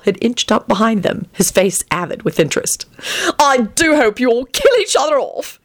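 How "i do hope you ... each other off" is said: high-pitched eager relish; excited. A narrator's reading. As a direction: say it with excited delight. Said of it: "he said excitedly".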